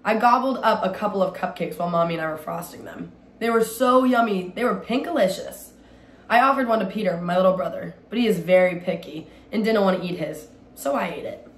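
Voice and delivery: ordinary delivery, nothing marked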